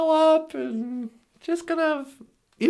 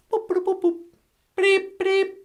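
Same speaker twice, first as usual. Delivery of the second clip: computer beeps; 4 semitones higher than usual. Acted.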